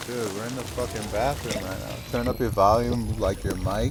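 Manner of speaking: hushed voice, hiding in the bathroom; whispering while hiding. Real, not acted. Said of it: "I can't talk loud because my son is sleeping".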